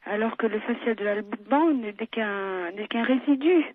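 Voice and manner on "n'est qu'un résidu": ordinary delivery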